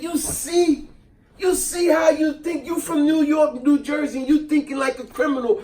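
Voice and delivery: retelling how he told off his buddy; upset scolding. Retold or imitated. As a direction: lecture him like you're fed up. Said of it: "I was so upset with him".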